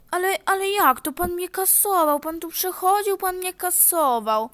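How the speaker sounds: high, loud flustered denial; mock outraged old lady; indignant protest. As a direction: protest your innocence. Accused passenger protests.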